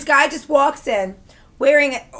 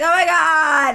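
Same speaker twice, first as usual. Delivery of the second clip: long, drawn-out campy exclamation; stretched-out mock delight; flamboyant gushing man; 2 semitones higher, slower than usual. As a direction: imitate a gushing flamboyant stranger. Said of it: "he's, he's like".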